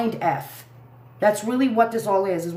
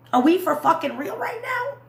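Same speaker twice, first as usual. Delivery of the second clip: outraged disbelief, much higher; incredulous outburst; 10 semitones higher than usual. Real, not acted. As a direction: pitch jumps high in disbelief. Outraged rant at FBI.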